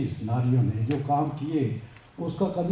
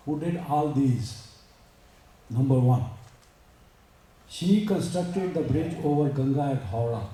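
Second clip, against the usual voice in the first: slow, measured English recitation; formal read-aloud; much slower than usual. Acted. Speaker reading a great woman's story to listeners, slow formal read-aloud. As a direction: read it out word by word, slowly.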